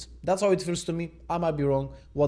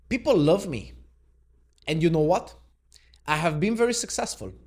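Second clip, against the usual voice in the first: higher, punchy boasting in a quoted voice; boastful president; 4 semitones higher, 5 dB louder than usual. Acted. Vlogger reading Trump's quotes to viewers, boastful. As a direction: brag it out like a politician at a rally. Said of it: "try to do an impersonation".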